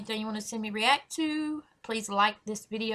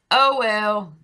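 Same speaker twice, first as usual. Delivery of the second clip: ordinary delivery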